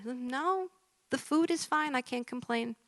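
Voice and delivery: high, soft little patient voice; small, uncomplaining put-on voice; resigned patient. Patient dismisses doctor's questions in joke.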